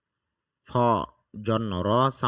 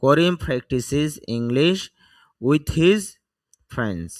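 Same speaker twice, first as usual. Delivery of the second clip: slow model reading; 2 semitones higher, much slower than usual. Shown as a demonstration. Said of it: "teacher reads model sentence slowly".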